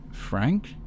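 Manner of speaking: high, hesitant questioning answer; nervous. Acted. Frank giving his name to Zack, high and nervous. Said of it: "Frank nervously answers".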